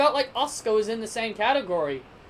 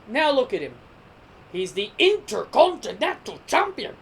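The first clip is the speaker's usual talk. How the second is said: a light, half-hearted Nigerian accent put on for fun; playful, hammed-up Nigerian accent cheering on the champ; bad Nigerian accent. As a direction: slip into a loose mock Nigerian accent to hype up Apollo. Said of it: "Me and my bad Nigerian accent".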